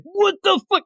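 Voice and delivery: hammed-up shocked yell; mock outraged yell. Green Arrow snapping at the detective, mock outraged yell. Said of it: "And then he's like".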